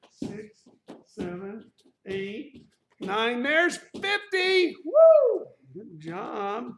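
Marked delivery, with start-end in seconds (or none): 3.4-5.5 very high, very loud whoop; excited cheer. Real, not acted. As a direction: celebrate the finish loudly. Finishing the jump rope set.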